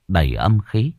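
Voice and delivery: ordinary delivery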